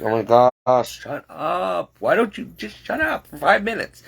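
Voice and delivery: high, rising fed-up cry; exasperated plea. Retold or imitated. Stuck with the talkative boss, a high exasperated plea. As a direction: beg him silently to stop talking. Inner reaction to chatty boss.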